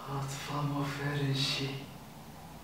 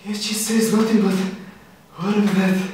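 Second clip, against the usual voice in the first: excited Romeo; 11 semitones higher than usual. Acted. Romeo puzzling over Juliet's silence, excited. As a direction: burst out with it, pitched up.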